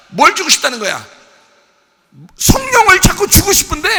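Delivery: loud, high impassioned preaching shout; full-force preaching. Real, not acted. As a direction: preach it out at the top of your voice. (목청 높여 외치는 열정적인 설교)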